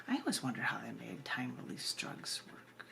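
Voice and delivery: unvoiced hushed aside; a secret thought whispered to the audience. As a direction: drop to a whisper as if confiding. Whispered aside within poem.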